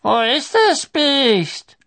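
high, loud, drawn-out demand; old official demanding, reedy voice; thin old man's voice. A narrator's reading. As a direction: call it out slowly, high and thin. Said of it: "die dünne Stimme des Alten".

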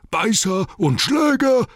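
high, loud, drawn-out yell; orc roar. A narrator's reading. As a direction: bellow it in alarm like a horde of orcs. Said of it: "brüllten sie".

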